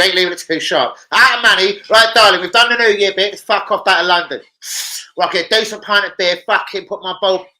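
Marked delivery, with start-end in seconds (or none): 1.1-4.4 loud high banter shout; playful loud send-off to a friend; mock yell. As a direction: bellow it at your mate as a joke. Mock-shouting at a mate on stream.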